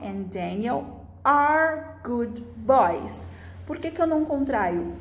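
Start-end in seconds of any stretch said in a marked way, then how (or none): 0.0-3.3 "are" spelled out slowly and clearly; slow teacher model, leaning on "are"; correct form stressed. Shown as a demonstration. Teacher models the correct sentence for students, stressing "are" slowly. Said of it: "o jeito correto de falar seria".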